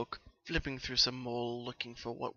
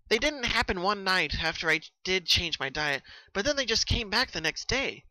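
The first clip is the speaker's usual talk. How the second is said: higher, louder bubbly pony voice; Pinky, subdued; 6 semitones higher, 5 dB louder than usual. Acted. Pinky telling Twilight when the dreams began. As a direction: explain it eagerly, a little worn.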